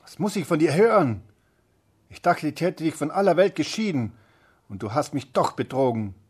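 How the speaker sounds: high, loud furious scolding; furious witch. Acted. The witch scolding Rapunzel for her betrayal, a high furious shout. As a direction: tear into the girl like a betrayed witch. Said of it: "rief die Zauberin".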